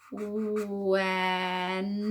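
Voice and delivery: very drawn-out went on an even pitch; said slowly. Shown as a demonstration. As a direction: stretch w, e, n, then release the t. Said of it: "Let's say it slowly".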